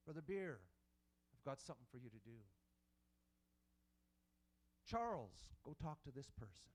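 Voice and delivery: low, hushed, barely voiced; gentle hushed inner voice; soft whisper of God. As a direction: speak it softly at the edge of thought. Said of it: "his voice is just that whisper".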